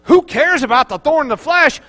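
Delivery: high, amazed questions building to loud joy; marvel, then exult in the good deal; acted-out thrilled discovery; excited realisation. Paul's delighted realisation, acted out.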